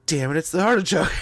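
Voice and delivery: imitating wife's sudden exasperated discovery; mock frustrated realization. Husband retelling wife's lunch, mock frustrated outburst. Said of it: "It's like".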